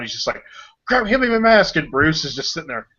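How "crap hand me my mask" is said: Terry freaking out. Retold or imitated. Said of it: "Terry's freaking out".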